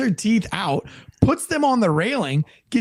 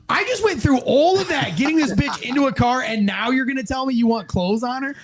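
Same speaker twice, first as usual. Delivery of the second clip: high-pitched incredulous complaint, easing down; exasperated protest; 5 semitones higher than usual. Retold or imitated. Officer told to dress the suspect, high exasperated protest. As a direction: start high in disbelief and settle as you go.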